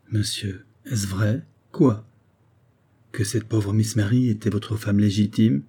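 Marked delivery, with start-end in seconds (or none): none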